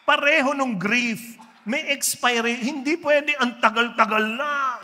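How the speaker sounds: loud, high pulpit voice; full-force preaching. Acted. Preacher driving the lesson on grief to the church. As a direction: hammer the point home.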